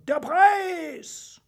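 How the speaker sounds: high, loud demand; united shout. A narrator's reading. Four contestants demand their prize together, shouting high. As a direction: call out like several voices at once. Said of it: "riefen Werner, Joschka, Erich und Norbert mit einer Stimme".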